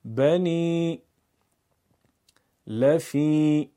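slow, loud, with the long i held; تکرار با کشش; drawn ya madi. Shown as a demonstration. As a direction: model the word for repeating. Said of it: "بعد از من تکرار کنید".

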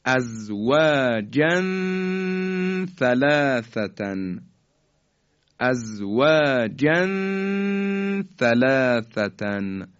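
ازواجن ثلاثتن slow, tanween nasalised before ث; slow recitation of ازواجن ثلاثتن; tanween ikhfa. Shown as a demonstration. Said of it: "دقت بفرمایید".

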